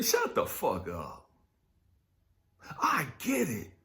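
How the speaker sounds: usual speaking voice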